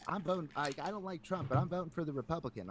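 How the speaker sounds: a hint of mom's protest; lightly imitated mom. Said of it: "And my mom's like".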